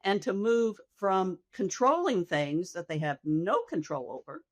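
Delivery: normal talking voice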